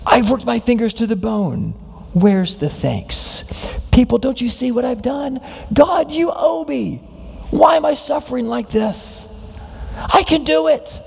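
proud heart demanding thanks from God. Retold or imitated. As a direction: complain that everyone owes you. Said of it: "But a proud heart says".

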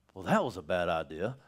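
low, soft mock-rueful God; voicing God. God regrets anointing Saul, low and rueful. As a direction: sigh it like a regret.

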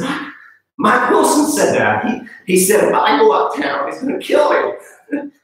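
put-on high, strained young kid voice; hammed-up tearful squeak, slowing at the end; emotional retelling. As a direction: imitate a scared kid getting choked up. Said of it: "I'm getting emotional about it".

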